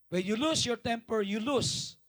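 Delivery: loud declaration, lean on lose; loud preaching. Acted. Pastor declaring to the crowd, loud preaching. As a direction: hammer the point home.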